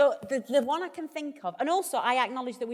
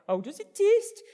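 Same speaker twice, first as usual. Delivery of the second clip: high, loud mock Geordie presenter; hammed-up Geordie host question; Geordie accent; 9 semitones higher, 6 dB louder than usual. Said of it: "Like that. I can't do a Geordie accent".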